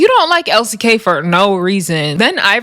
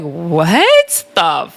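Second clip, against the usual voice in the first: drawn-out, then high-pitched disbelief; incredulous; 8 semitones higher, slower than usual. Retold or imitated. Vlogger recounting her reaction to Rolly's excuse, incredulous. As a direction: stretch the opening slowly, then leap up in pitch.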